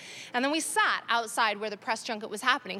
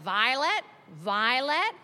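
imitated name call, rising each time; barista calling out; much slower than usual. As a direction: call a customer's name as a question. Barista calls out customer's name.

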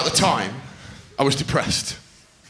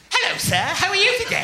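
very high, prim schoolgirl impression; repressed forties housewife. Eleven-year-old greeting her teacher, housewife falsetto. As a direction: chirp it like a repressed 1940s housewife. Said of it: "like a repressed nineteen forties housewife".

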